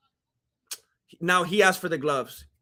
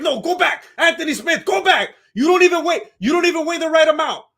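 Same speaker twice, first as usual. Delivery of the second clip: higher, louder shooing voice of a guard; mock security guard; 7 semitones higher, 4 dB louder than usual. Guards sending Anthony Smith back, higher mock shooing voice. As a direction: wave him off like a bouncer. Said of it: "the security guards are like".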